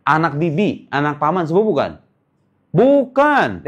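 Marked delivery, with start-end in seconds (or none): 2.7-3.7 incredulous. Real, not acted. Correcting pupils' wrong answer.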